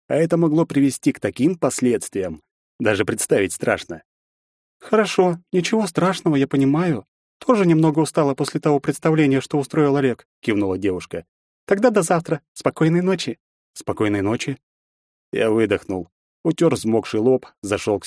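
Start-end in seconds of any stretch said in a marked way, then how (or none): 4.8-10.3 put-on high, friendly girlish voice; cheerful younger sister. A narrator's reading. Olga answers understandingly. 11.7-12.4 put-on high, friendly girlish voice; cheerful younger sister. A narrator's reading. Olga says goodnight. 12.5-13.4 put-on high, gentle girlish goodnight; sweet sister saying goodnight; girl's goodnight. A narrator's reading. Olga says goodnight.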